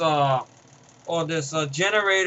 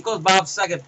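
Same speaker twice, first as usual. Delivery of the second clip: normal talking voice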